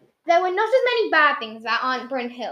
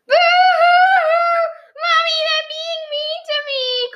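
put-on very high, loud, drawn-out child's wail; hammed-up crying; 7 dB louder, slower than usual. A narrator's reading. Small boy complains to his mother, exaggerated high wailing. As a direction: wail like a little boy putting on tears. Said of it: "cried Michael".

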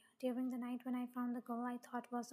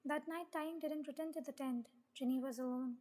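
ordinary delivery

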